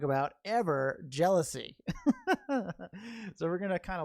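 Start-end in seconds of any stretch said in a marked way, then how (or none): 1.8-2.4 short real chuckle; light burst of laughter; laughing. Real, not acted. Meditation teacher amused after announcing jealousy as topic, laughing.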